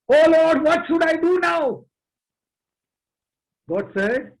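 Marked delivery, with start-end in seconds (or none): 0.1-1.8 higher and louder, begging; desperate cry. A narrator's reading. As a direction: call out to the Lord in panic. Thomas crying out to God.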